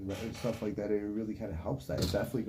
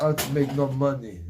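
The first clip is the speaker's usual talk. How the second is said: put-on Russian accent; 3 semitones higher, 8 dB louder, slower than usual. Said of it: "giving my Turkish parents a Russian accent".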